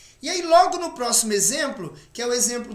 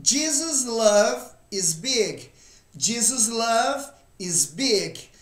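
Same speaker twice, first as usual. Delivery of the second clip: slow, careful English model sentence; slow model reading; much slower than usual. Shown as a demonstration. Teacher models the sentence slowly for students. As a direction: read it slowly, twice. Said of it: "Próximo exemplo".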